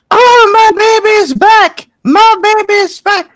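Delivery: high, drawn-out motherly shriek of joy; doting mother's squealing cry; overjoyed mom yelling. Acted. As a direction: wail it out high and loud, thrilled. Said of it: "starts yelling into the".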